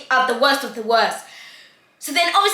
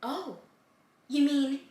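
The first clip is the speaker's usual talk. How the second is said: soft, hushed mock weeping; small tearful voice, barely out; imitated tearful; 9 dB quieter, slower than usual. Crying girl starting her reveal, tearfully hushed. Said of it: "Full tears crying".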